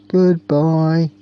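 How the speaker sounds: friendly ghostly shout. Acted. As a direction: call farewell after the leaving children. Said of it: "screamed the ghosts in a friendly way".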